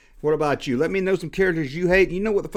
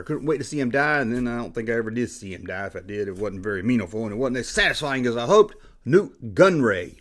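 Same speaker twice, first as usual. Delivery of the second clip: usual speaking voice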